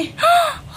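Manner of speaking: shocked gasp of horror; sharp high gasp. Acted. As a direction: gasp in alarm. Sees cow's broken legs.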